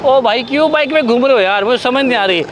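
ordinary talk, nothing marked

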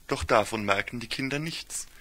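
plain reading voice

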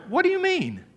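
high, squeaking disbelief; student hearing he cannot drop the class, stunned. Retold or imitated. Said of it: "I just could not believe".

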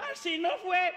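high, slow indignant protest; indignant mother. Retold or imitated. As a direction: correct him like an offended mom.

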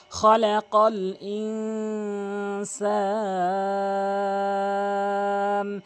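slow, drawn-out melodic tajweed chant; Quran recitation. Acted. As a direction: recite each word long and sung. Recites the verses.

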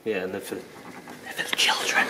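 hushed, slow whisper. Real, not acted. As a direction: whisper it as a secret aside. Joking aside about Christmas presents.

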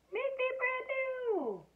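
very high, slow, squeaky voice; high melted snowman voice. A narrator's reading. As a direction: squeak it from the puddle. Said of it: "a voice from the puddle said".

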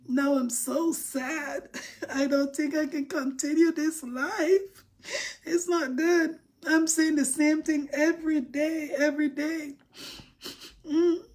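theatrical pretend cry about poor likes; mock crying. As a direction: fake sobbing with laughs breaking through. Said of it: "since I cried".

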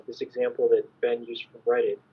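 normal talking voice

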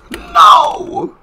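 loud, very high-pitched shriek; piercingly high cry of refusal; terrified scream. Real, not acted. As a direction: scream it as you are caught. Chased in game.

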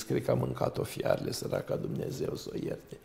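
whispered, sly pretend mourning; hushed fake pity. Devil whispering fake pity at the hermit's door. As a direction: breathe it like a false condolence.

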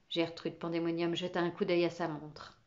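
ordinary delivery

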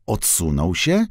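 usual speaking voice

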